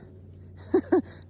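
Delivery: high, loud scornful burst of laughter; raucous laugh. A narrator's reading. Peacock hears it must be happy, laughs raucously. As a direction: laugh harshly before answering. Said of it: "The peacock laughs his raucous laugh".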